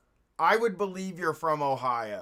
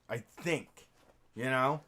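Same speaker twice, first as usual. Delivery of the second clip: usual speaking voice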